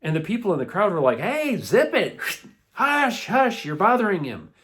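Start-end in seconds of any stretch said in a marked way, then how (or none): 1.2-4.5 loud, high telling-off; scolding shushing. A narrator's reading. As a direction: hush someone sharply, raised voice. Crowd scolds the shouting man.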